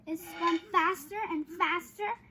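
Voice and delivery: usual speaking voice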